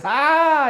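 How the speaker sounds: hammed-up second yelp. Recognition gag, repeated louder.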